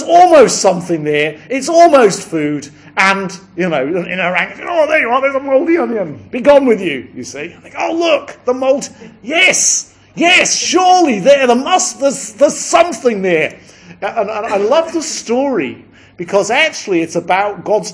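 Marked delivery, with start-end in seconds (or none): 4.6-7.1 put-on high, loud, eager old woman voice; high excited old woman; old woman searching for food. Woman in hell voiced in story. 7.8-13.6 high, loud, eager exclamations; high excited old woman, nearly shouting. Acted. Woman voiced searching in hell.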